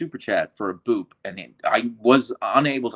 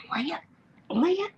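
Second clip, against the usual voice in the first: very high soft call; calling sweetly; 5 dB quieter than usual. Real, not acted. Owner calling her husky for a nose boop, very high.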